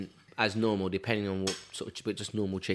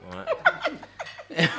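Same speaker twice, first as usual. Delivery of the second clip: laughing. Retold or imitated. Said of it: "he was like".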